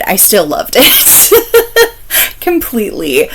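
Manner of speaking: high, slow laughing reaffirmation; gushing with a laugh. Real, not acted. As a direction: giggle through it, drawn out and high.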